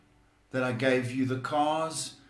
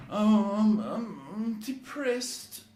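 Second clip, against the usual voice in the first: high, slow, whiny mock-depressed voice; mock moping; 8 semitones higher than usual. Psychic imitating a gloomy friend to the caller, slow whiny moan. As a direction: moan it like a self-pitying friend. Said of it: "you get some people like that".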